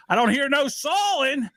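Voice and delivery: high, slow impression of dad; dad's voice. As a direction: imitate a puzzled dad, drawn out and high. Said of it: "my dad came out because he didn't hear".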